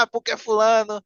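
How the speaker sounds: high-pitched griping, imitating annoyed contestants; put-on indignant tattling, pitched well up; mock angry complaining. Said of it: "o pessoal fica com raiva".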